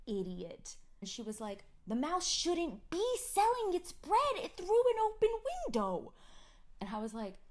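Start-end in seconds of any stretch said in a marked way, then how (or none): 1.9-6.1 put-on much higher, louder kid voice; scolding toddler; imitating a child. Mother imitating daughter, high scolding kid.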